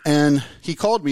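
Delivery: usual speaking voice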